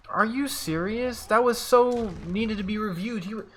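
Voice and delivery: exasperated and high; incredulous protest. Real, not acted. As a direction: protest a blown call, pitch way up. Protests a call not reviewed.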